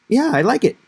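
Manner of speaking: high, loud, breezy yeah-I-like-it; offhand student reply. Retold or imitated. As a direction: toss it off like a casual student. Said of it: "A lot of times we respond and we say".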